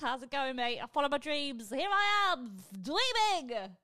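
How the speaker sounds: high, smug sing-song voice. Pretend. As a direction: gloat sweetly down the phone. Imagined smug call to recruiter.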